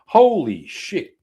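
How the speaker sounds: slow, high, loud drawn-out disbelief; real drawn-out shock; stunned exclamation. Real, not acted. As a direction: stretch it out, floored. Reacting to clip in disbelief.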